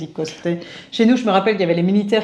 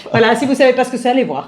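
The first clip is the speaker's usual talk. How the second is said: upbeat call to viewers; 5 semitones higher, 5 dB louder than usual. Real, not acted. Cheerful sign-off to viewers.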